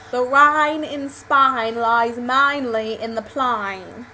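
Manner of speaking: slow, stretched British vowels; British accent demo. Shown as a demonstration. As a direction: recite it slowly in a broad British accent. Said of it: "sentences that would sound particularly British".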